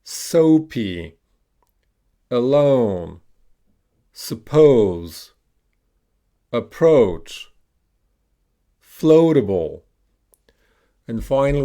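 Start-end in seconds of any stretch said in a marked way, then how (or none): 0.0-9.8 each word alone, drawn-out OH as in "alone"; "approach" with a strong long OH, falling tune; long O modelled. Shown as a demonstration. Teacher has learners repeat long O words, careful drill delivery. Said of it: "we're gonna pronounce the letter O".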